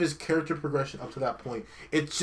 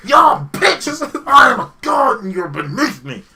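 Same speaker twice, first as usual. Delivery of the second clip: much louder, higher mocking teen yell, laughing through it; mock tantrum; 12 semitones higher, 13 dB louder, slower than usual. Atreus raging at everyone after hearing he's a god, hammed-up yell. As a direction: scream it like a spoiled kid turned god. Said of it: "And then he's like".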